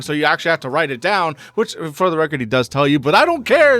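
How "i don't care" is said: much higher, louder defiant shout; loud, high indignant outburst; shouted dismissal. Real, not acted. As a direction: yell it out in defiance. Dismissive repeated refrain.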